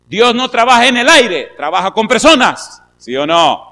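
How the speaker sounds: high, loud pulpit shout; full-force preaching. Real, not acted. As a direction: hammer it home to the congregation.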